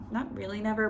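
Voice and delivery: usual speaking voice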